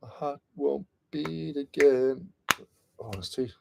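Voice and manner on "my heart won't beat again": mock dramatic.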